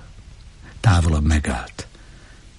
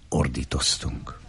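usual speaking voice

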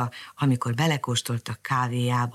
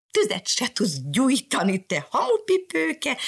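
higher, slower scolding cry from the cat; mock cat shout; 10 semitones higher, slower than usual. Cat shouts at Mariska over the fire, higher mock scolding. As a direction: call her names like a cheeky cat. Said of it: "kiáltott rá Mariskára".

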